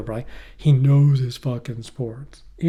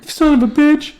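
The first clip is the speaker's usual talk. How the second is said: high, loud hammed-up indignation; mock outrage; 14 semitones higher, 8 dB louder than usual. Hurt boy cursing his dad, high mock outrage. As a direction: exclaim like a wronged kid.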